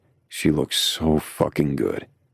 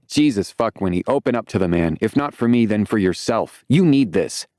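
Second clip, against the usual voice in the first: shouting; 6 semitones higher than usual. A narrator's reading. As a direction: yell it over the phone to her. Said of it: "Shouting to me".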